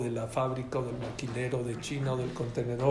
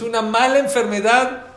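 loud and raised, pressing the point; emphatic loud; 7 semitones higher, 10 dB louder than usual. Real, not acted. Rabbi to audience, loud emphatic warning. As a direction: hammer home that it's a bad illness. Speaker stresses bad illness.

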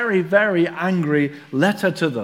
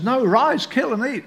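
a hint of a commanding God, higher; lightly voiced divine command; 6 semitones higher than usual.

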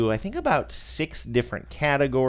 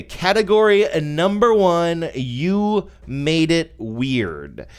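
announcer voice; 6 semitones higher, 4 dB louder, much slower than usual. Pretend. Announcing first award category.

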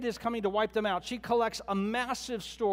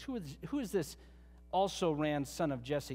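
mild sneer; 4 semitones lower, 5 dB quieter than usual. Retold or imitated. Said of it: "with a big sneer on his face".

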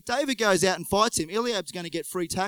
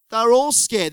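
louder repeat; 4 semitones higher, 7 dB louder than usual. Shown as a demonstration. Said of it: "repeated for emphasis".

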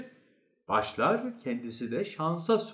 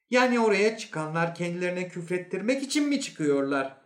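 high, incredulous question; disbelieving inspector; 7 semitones higher than usual. Acted. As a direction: challenge him in a raised pitch. Inspector disputes the psychologist's claim.

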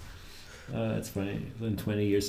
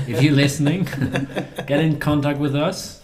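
laughing; 5 semitones higher, 7 dB louder than usual. Real, not acted. Joking appeal to civil servant.

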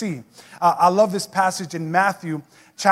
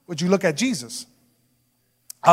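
usual speaking voice

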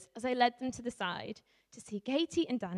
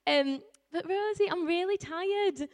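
put-on whiny child; 6 semitones higher than usual. Eight-year-old whining in story.

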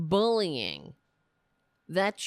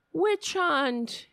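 ordinary talk

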